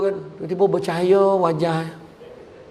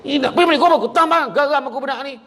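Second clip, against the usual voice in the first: scolding mother; 10 semitones higher, much faster than usual. Acted. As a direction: rant at the child in fury. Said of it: "Dia marah habis budak tu". (ibu marah anak, suara tinggi)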